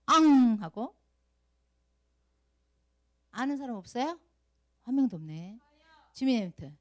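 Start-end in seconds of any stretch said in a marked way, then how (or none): 0.1-0.6 sudden loud mock wail, higher pitched; imitated child's cry. Teacher quizzes children on why Sua cries, mock bawling. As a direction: burst into pretend bawling. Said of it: "우리 수아 왜 울까?".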